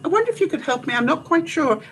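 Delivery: high, timid little request voice; sweetly polite asking. Retold or imitated. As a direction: play the innocent asking for help. Asking firemen for help politely.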